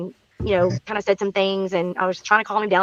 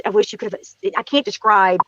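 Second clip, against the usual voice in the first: ordinary delivery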